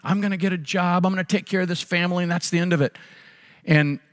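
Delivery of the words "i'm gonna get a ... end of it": raised higher, dad yelling his promise; a mild mock yell, defiant and insistent; imitating a sick father shouting he'll get a job; retold yelling. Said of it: "he was yelling".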